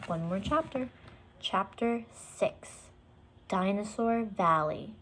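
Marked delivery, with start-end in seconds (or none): none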